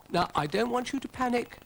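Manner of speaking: high, loud mock calm; high character voice. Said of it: "said Dougal".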